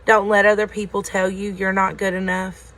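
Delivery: ordinary delivery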